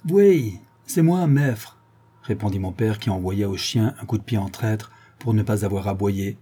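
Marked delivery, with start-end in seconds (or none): 0.1-1.8 higher-pitched, firm doorstep answer; answering a caller. Acted. Father answers the stranger at the door, speaking up. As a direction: speak up to the visitor.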